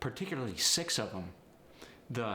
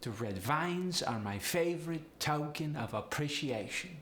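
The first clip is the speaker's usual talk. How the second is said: higher Polish accent, rising at the end; sing-song Polish upward inflection; 5 semitones higher than usual. Shown as a demonstration. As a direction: lift the diphthongs like a Polish speaker. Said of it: "upward inflection with these sentences".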